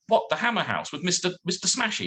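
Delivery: high, disbelieving question; incredulous. Retold or imitated. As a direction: ask it as if you can't believe your ears. Incredulous quote to client.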